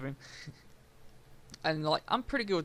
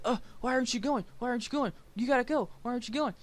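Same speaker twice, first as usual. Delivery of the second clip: higher, nagging mock of the girlfriend; 8 semitones higher, faster than usual. Imitating his girlfriend nagging him to pull out, higher and nagging. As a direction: pester him over and over in her voice.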